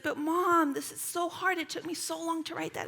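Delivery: high, whiny daughter impression; imitated complaining child. Frustrated daughter protesting to mom over lost homework, imitated complaining. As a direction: mimic an upset child's high-pitched protest. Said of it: "complaining and crying".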